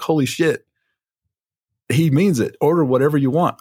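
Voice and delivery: stunned that it worked, voice jumps up; amazed. Retold or imitated. Customer amazed debt vanished.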